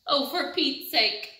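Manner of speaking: ordinary delivery, nothing marked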